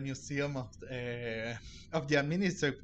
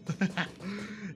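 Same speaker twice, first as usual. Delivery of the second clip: short laugh; 6 semitones higher than usual. Real, not acted. Streamer laughs at own slip.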